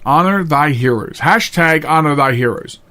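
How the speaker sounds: ordinary talk, nothing marked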